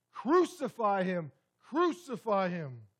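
loud, slow crowd shout; slow, loud chant of the crowd; crowd's cry. Retold or imitated. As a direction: cry it out like a baying mob. Crowd crying out for crucifixion.

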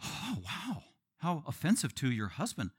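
soft, swooping mock affront; offended. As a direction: gasp it quietly, as scandalized relatives. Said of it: "they were probably offended".

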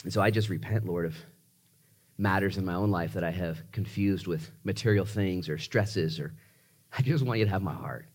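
low, soft, unhurried pastor's prayer; congregational prayer. Real, not acted. Pastor closing offering prayer with his church, soft and prayerful. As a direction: pray quietly, pausing between phrases.

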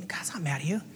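whispered, soft and intimate; hushed whisper. Pretend. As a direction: lean in and whisper it. Hushed twist in skit.

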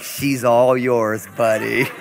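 drawn-out wry handover; deadpan handoff. Retold or imitated. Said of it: "And I'll be like".

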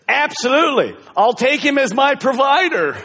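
chirpy eager bride accepting; bride's reply. Retold or imitated. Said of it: "And she says".

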